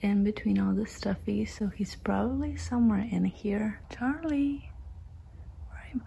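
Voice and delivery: soft whisper. Real, not acted. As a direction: whisper so the kitten sleeps. Kitten sleeping, whispering.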